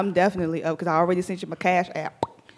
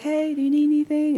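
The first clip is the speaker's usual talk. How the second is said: bright caring check-in; 8 semitones higher than usual. Retold or imitated. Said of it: "crack open the door and be like".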